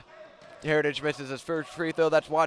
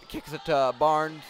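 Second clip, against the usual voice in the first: ordinary delivery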